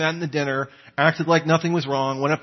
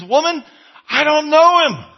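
sharp, much louder and higher protest; loud denial; 12 semitones higher, 10 dB louder than usual. Retold or imitated. Peter loudly denying to the servant girl. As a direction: fling the denial back at her.

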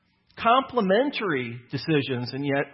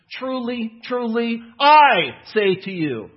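solemn declaration; 6 semitones higher, 7 dB louder, slower than usual. Acted. Preacher quoting Jesus' solemn formula.

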